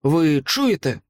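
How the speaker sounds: a sharp, insistent ask; sharp question. A narrator's reading. Elf prince asks companions about a distant sound, sharply. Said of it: "різко сказав він".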